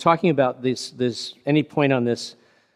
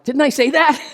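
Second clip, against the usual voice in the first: high, loud, hammed-up "didn't I?"; mock exasperation; 8 dB louder than usual. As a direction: throw it up in disbelief. Retold exasperation at being misunderstood.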